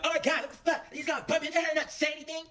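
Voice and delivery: put-on high, indignant girl voice; mocking angry girl. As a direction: mimic an offended girl snapping. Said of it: "one of the girls just looks over like".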